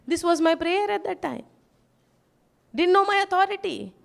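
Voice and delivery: ordinary delivery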